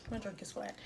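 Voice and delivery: quiet letdown; deflated mutter. Real, not acted. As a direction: let it drop to a disappointed murmur. Repeats the complaint, deflated.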